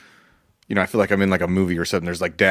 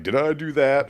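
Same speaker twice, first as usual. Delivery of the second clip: mock catchphrase; 5 semitones higher, 6 dB quieter than usual. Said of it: "my catchphrase".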